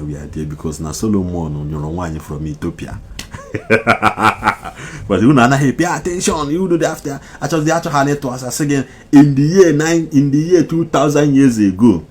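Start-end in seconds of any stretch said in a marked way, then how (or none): 5.1-12.0 high and loud, fired up; raised excited voice. Real, not acted. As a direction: push it higher, ranting. Heated rant rising.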